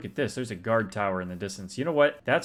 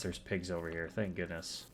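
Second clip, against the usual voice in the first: ordinary talk, nothing marked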